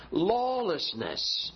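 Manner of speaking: Lawlessness drawn out slowly, falling at the end; slow emphatic word. Shown as a demonstration. Preacher to congregation, dwelling slowly on Lawlessness. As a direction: stretch out Lawlessness. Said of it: "lawlessness in First John".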